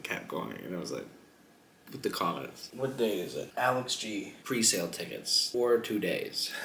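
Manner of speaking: ordinary talk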